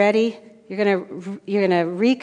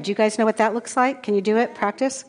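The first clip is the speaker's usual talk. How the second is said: ordinary delivery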